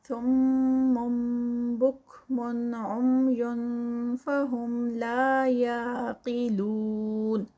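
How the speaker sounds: slow, drawn-out melodic recitation with long held vowels; Quran recitation. Shown as a demonstration. Teacher reciting the verse for students, slow and melodic. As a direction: recite it slowly, stretching each madd. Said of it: "tajweed teacher recites the verse".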